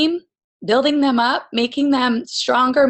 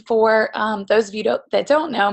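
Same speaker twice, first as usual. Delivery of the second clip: ordinary delivery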